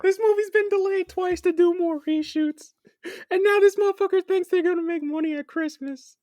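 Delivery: high squeaky mocking voice. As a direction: whine it like a cartoon. Mocking rant, high put-on voice.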